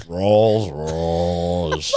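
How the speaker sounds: exaggerated, drawn-out R's on "Rolls Royce"; mocking rolled R's. Co-host mocks host's example, "Rolls Royce" with overdone R's. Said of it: "they're fucking robots".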